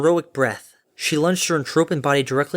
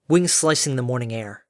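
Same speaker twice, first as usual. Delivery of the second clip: ordinary delivery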